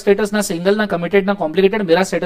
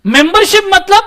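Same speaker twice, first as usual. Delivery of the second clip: high rising tease; 11 semitones higher, 7 dB louder than usual. Shown as a demonstration. Teacher builds suspense.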